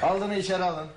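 calling out. Acted. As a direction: call it through the door. Calling an order to the door.

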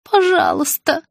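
ordinary delivery

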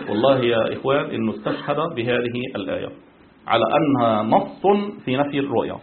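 usual speaking voice

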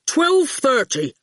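slow, terse announcement; slow goon voice. Acted. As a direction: plod out each word. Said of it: "the goon announced".

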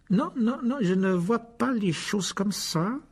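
high, slow, drawn-out refusal; icy condescension. A narrator's reading. Rogue stops Harry pairing with Hermione, icily condescending. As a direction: refuse slowly with an icy smile. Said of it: "dit Rogue avec un sourire glacial".

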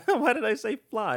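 laughing at own slip. Real, not acted. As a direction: giggle through the question.

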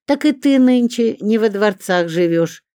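high, loud comeback; tit-for-tat retort. A narrator's reading. Zinka hitting back at Olga, sharp retort. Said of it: "не осталась в долгу Зинка".

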